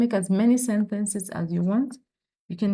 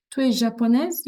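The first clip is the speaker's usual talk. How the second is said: JAPONAISE raised high as a question; question intonation; 4 semitones higher, 3 dB louder than usual. Shown as a demonstration. French teacher shows how to ask a question, rising intonation. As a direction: make "japonaise" climb, asking. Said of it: "You can ask a question".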